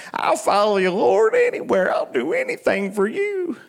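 high, put-on voice of a gushing disciple; over-eager devotion. Peter pledging himself to his Lord, mock over-eager devotion. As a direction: mimic a zealous follower, pitched well up.

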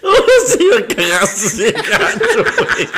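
laughing hard, words squeezed out between laughs; can't stop laughing at falling for the joke; laughing at himself. Real, not acted. Friend laughing at himself for falling for the joke. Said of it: "me río de yo mismo".

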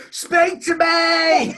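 high, slow, loud mock begging; mock plea shout. Interviewer joins the joke with a hammed-up loud plea.

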